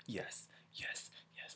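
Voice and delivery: soft whispered yes yes; quiet triumphant whisper. Real, not acted. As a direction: hiss it in triumph. Thrilled by comeback.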